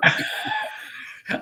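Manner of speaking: high, breathy, wordless laugh; high unvoiced laugh; burst of laughter. Real, not acted. Co-host caught not watching the screen.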